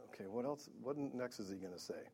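a hint of unbothered, quiet waiting; God sitting back. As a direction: murmur it like someone settling back to wait. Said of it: "I'm sure God's just sitting back".

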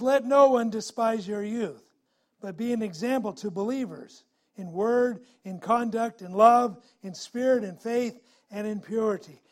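preacher reading Paul's words to the congregation; scripture reading. Acted. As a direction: read the verse with preaching force. Reads scripture.

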